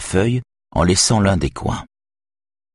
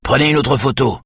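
ordre crié; 8 semitones higher than usual. A narrator's reading. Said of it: "crie Yona d'une voix forte".

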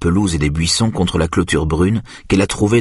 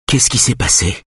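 mildly impatient question. A narrator's reading. Simone pressing Aïda for what happened, mildly impatient. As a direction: ask with a touch of impatience. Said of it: "dit Simone d'une voix impatiente".

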